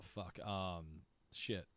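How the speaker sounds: ordinary talk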